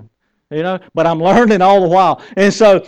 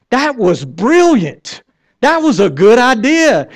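drawn-out, higher-pitched gushing; impressed praise; 5 semitones higher, 4 dB louder, slower than usual. Acted. Onlooker praising someone's idea, loud and impressed. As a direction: gush like an amazed admirer.

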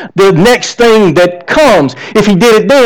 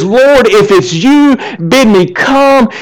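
slow, raised plea to the Lord; calling out; 4 semitones higher, slower than usual. Retold or imitated. Peter calls to Jesus from a sinking boat, calling out slowly. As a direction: stretch it out, lightly acting a man calling across water.

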